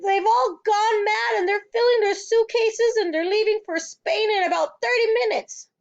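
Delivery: put-on panicked child explaining; distressed crying. A narrator's reading. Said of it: "she cried".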